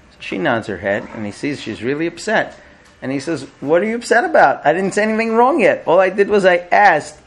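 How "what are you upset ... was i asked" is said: high-pitched defensive husband in a retold quarrel; mock exasperated self-defence; exasperated protest. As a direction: protest innocence, pitch jumping up.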